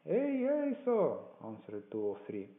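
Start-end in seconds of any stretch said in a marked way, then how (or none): 0.1-1.2 high-pitched answering call; crew calling back. A narrator's reading. Men answering the blind beggar's order, high eager call.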